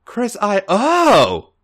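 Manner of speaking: breaks off high into a gasp; stuttered gasp. A narrator's reading. A J stuttering as Alex grazes his thigh, breathless. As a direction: stutter then let the oh escape. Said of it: "A J stuttered out".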